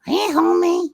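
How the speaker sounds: very high, rising Marge voice; Marge Simpson impression. Shown as a demonstration. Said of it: "Marge has a way of going up".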